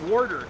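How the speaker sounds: "Water" with an R in the first syllable, "wor-der"; "Water" mimicked with an intrusive R; disowned pronunciation. Shown as a demonstration. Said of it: "I don't say water".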